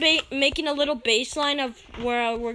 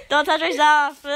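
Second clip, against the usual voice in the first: laughing through the words; giggling while talking; 3 semitones higher, 4 dB louder than usual. Real, not acted. Laughing during fight.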